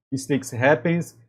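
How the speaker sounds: ordinary talk, nothing marked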